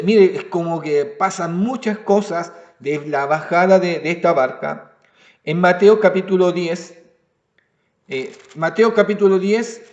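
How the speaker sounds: ordinary talk, nothing marked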